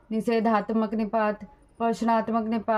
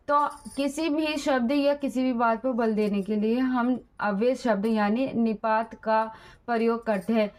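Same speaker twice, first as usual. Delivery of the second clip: ordinary delivery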